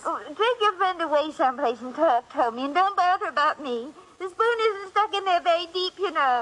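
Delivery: flustered put-on English accent; trying to send Tony away in her faked accent. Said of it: "your accent".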